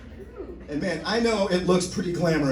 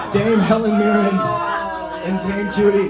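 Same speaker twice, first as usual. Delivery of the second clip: mock grand announce; 5 semitones higher, 5 dB louder than usual. Comic boasting about dream.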